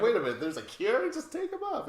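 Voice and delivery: high, loud, laughing amazement; mock excited discovery. Retelling the family finding a cure, high laughing amazement. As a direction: gasp it out like a miracle cure. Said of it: "They're like".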